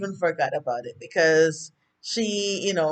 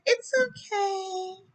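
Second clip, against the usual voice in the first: high soft; 14 semitones higher, 5 dB quieter, much slower than usual. Retold or imitated. Wife forgiving husband.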